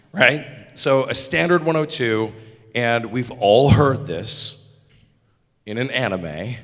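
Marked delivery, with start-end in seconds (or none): none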